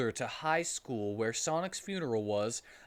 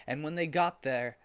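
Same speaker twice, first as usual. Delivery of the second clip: ordinary delivery, nothing marked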